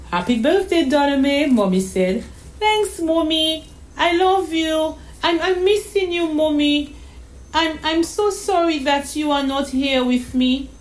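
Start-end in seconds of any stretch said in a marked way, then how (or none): 0.1-1.5 higher, louder loving mom; mother's voice. Acted. Mother calling her daughter on her birthday, cheerful. 2.6-10.7 put-on little girl's voice. Daughter missing her mother on the phone, wistful.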